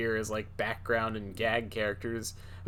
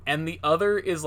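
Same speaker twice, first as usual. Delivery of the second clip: usual speaking voice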